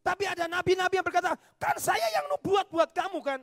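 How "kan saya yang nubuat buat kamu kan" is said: mock claim. False prophet claiming credit.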